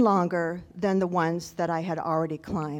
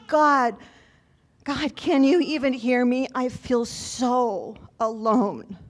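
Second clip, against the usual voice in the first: higher-pitched retold plea to God; crying out in prayer; 3 semitones higher than usual. Pilgrim alone on the mountain calls out to God, acted anguished plea. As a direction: re-enact an anguished cry to God. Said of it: "I shouted, I cried, I prayed".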